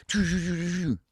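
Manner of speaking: high train sound effect; choo choo. Retold or imitated. Telling friends how the trip blurred, high choo choo. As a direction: mimic a train chugging through time.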